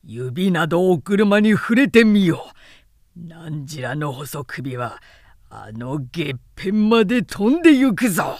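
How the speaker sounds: loud, menacing warrior's threat; furious threat. A narrator's reading. General threatens the gate soldiers, furious and loud. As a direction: glare and roar the warning. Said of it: "関羽が目を怒らして".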